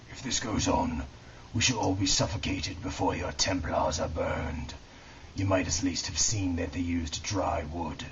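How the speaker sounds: lightly voiced haughty lord, put out by smoke; complaining nobleman. A narrator's reading. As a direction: voice a mildly peeved aristocrat making a remark. Valois complaining within the story.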